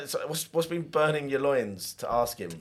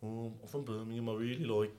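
Brummie accent attempt; 12 dB quieter than usual. Shown as a demonstration. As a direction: mumble out a tentative Birmingham accent. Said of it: "Brummie accent".

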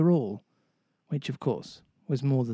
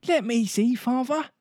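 put-on much higher, a small boy pleading eagerly; eager begging; 10 semitones higher than usual. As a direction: beg to see, bouncing with eagerness. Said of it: "Michael begged eagerly".

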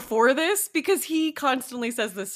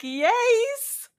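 excited; 13 semitones higher, 5 dB louder than usual. Retold or imitated. Gushing over punk phase scene.

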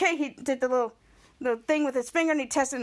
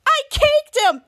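very high, loud boast; gloating; 12 semitones higher, 8 dB louder, slower than usual. Retold or imitated. Piper telling Jake about her prank, gloating. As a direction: squeal it with proud glee.

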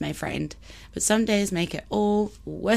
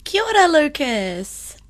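bright greeting; 7 semitones higher, 7 dB louder than usual. Real, not acted. Warm greeting to listener.

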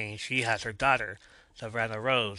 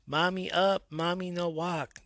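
put-on toddler voice; 8 semitones higher than usual. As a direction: ask like a tiny child wanting up. Little son asking to be carried.

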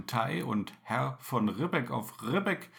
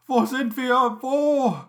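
high, loud frightened cry; panicked cry; 10 semitones higher, 6 dB louder than usual. Acted. Panicked passengers crying out.

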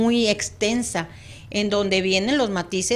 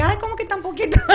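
higher and louder, laughing through the words; laughing mock complaint; 9 semitones higher, 8 dB louder, faster than usual. Guest retells her reaction to the short airtime, laughing. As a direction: act out your own surprised protest while laughing. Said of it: "así como que dije".

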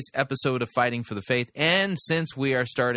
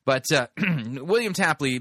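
normal talking voice

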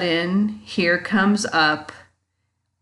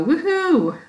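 high-pitched pretend woohoo; mock excited cheer; 10 semitones higher than usual. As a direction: cheer as if thrilled to get up. Imagined excited start to a day.

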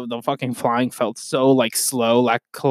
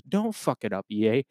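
normal talking voice

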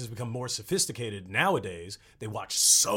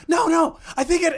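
high, loud pleading shout; insistent shout; 11 dB louder than usual. Retold or imitated. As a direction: shout it like you won't back down. Retells insisting on the sketch.

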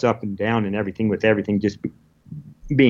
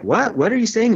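lightly voiced wife, much higher, puzzled; a hint of the baffled wife on a bad line; confused wife; 11 semitones higher than usual. Wife on the satellite phone not understanding him, lightly voiced puzzlement.

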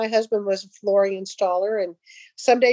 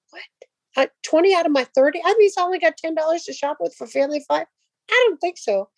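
incredulous protest; 5 semitones higher, faster than usual. Retold or imitated. Retorting to God, reluctant.

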